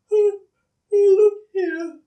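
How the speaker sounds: sleepy koala yawning. Acted. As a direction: yawn through it. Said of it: "he yawned".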